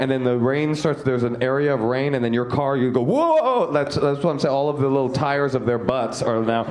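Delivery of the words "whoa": startled whoa. Retold or imitated. Driver skidding on the rain.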